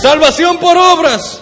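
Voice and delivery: high, loud, drawn-out accusation; mock outcry. Interpreter echoing the preacher's mock accusation, mock outcry. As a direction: echo the outraged charge, slowly.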